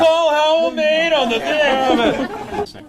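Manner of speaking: high-pitched mock sports-show outrage; excited sports pundit. Host imagining tomorrow's Sportsnet talk of his call, mock excitement. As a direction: pitch it way up like a scandalised commentator. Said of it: "they'll be on, be on Sportsnet".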